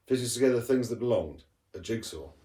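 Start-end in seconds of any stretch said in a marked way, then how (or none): none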